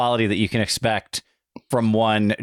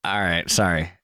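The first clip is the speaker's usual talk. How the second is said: ordinary delivery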